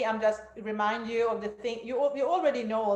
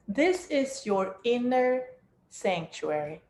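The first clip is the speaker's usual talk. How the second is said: guided meditation; 4 dB louder than usual. Acted. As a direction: guide them down into stillness.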